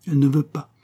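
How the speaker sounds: plain reading voice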